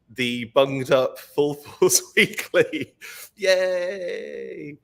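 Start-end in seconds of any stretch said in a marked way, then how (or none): none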